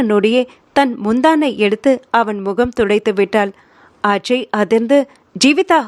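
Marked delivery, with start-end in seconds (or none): 5.4-5.8 sharp rebuke. A narrator's reading. Ajay rebukes Jeevitha for wiping his face, sharp. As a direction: stop her with one sharp word. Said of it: "என்றான் அதட்டலாக".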